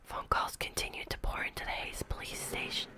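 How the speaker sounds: plain reading voice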